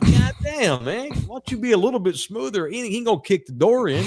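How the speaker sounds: high-pitched, incredulous mock complaint; acted-out alarmed scolding; retold exasperation. Acting out his exasperated reaction to her.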